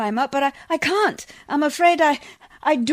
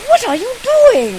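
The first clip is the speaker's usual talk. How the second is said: high, loud, startled outcry; outraged at finding him so close; shocked demand; 10 semitones higher, 8 dB louder than usual. Acted. Woken lady finds man nearby.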